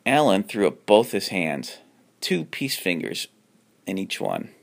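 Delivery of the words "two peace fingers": usual speaking voice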